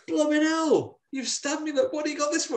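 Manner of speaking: high squeaky demon outrage; stabbed demon. Retold or imitated. Demon squeals at the woman who stabbed him. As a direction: squeal it like a shocked cartoon demon. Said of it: "And he goes".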